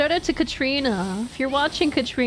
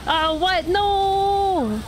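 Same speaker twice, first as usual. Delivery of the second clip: loud, high, drawn-out mock protest; hammed-up disbelief, pitched up; mock dismay; 8 semitones higher, 5 dB louder, much slower than usual. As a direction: wail a long "no" in pretend shock. Mock dismay at hurricane joke.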